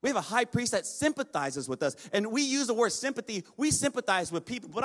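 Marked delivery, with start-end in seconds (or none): none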